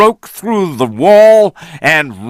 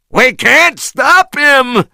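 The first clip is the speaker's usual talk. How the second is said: high panicked yell; panicked shout; 12 semitones higher than usual. A narrator's reading. A sailor crying out as Kong rises, panicked. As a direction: cry out in alarm. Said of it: "shouted one of the sailors".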